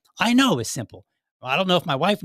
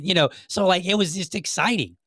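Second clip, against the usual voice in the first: ordinary talk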